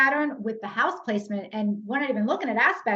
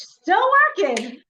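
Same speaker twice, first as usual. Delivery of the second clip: playful drawn-out tease, higher and louder; teasing; 7 semitones higher, 7 dB louder, slower than usual. Real, not acted. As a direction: rib her for working on her trip. Teasing friend working on vacation.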